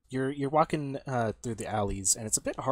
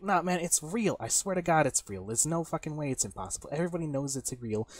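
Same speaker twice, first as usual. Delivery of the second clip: higher-pitched protest in a bar argument; heated insistence; 6 semitones higher than usual. Acted. Bar patron swearing the myth is real, heated insistence. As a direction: insist like an arguing barfly.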